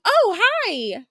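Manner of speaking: high, bright reply; mock sunny reply; chirpy customer. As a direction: answer like a pleased customer. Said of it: "they'll be like".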